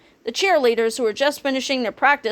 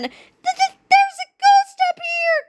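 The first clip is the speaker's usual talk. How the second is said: very high stuttering shriek; Wendy crying to Kipper, high stuttering fright; stammering terror; 3 dB louder, slower than usual. A narrator's reading. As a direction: stutter it in panic. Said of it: "cried Wendy Swan".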